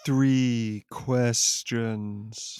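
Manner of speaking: very slow, drawn-out godly decree; solemn god voice. Acted. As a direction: intone it like an ancient deity. Elder God insisting on rules.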